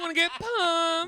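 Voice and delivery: high, loud, slow hype; cheesy promo voice. Pretend. Host pitching a joke title, hammed up. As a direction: sell it like a cheesy trailer. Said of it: "We're pumping out titles tonight".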